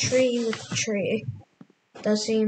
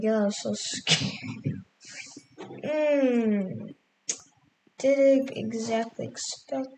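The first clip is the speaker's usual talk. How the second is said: ordinary delivery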